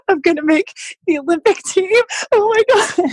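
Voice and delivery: high, overwhelmed cry of joy; realizing the dream mid-race; sobbing-happy disbelief; tearful joy. Retold or imitated. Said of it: "So I started crying while I was swimming".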